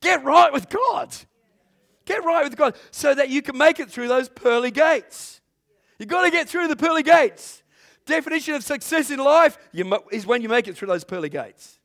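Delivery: loud, high, ringing sermon cry; preaching at full force. Acted. Preacher exhorting the congregation, loud and high. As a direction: preach it out to the whole church.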